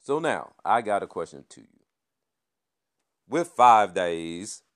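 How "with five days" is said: slow, loud, drawn-out "five"; drawn-out loud opener. Real, not acted. Opening the Christmas question to viewers, loud drawn-out. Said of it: "let me back that thing up".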